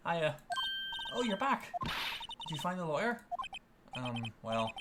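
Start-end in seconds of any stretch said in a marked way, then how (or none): 0.1-3.2 higher, bright greeting; lightly voiced girl Maya; cheerful young girl. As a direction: welcome him back eagerly.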